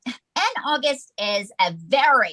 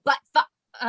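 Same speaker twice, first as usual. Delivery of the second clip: usual speaking voice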